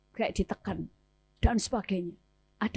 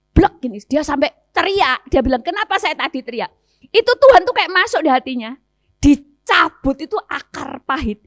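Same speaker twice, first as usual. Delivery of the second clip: preaching at full force, higher and louder; fervent preacher climax; loud, building climax; 4 semitones higher, 11 dB louder than usual. Real, not acted. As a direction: belt out the breakthrough. Climax of deliverance story.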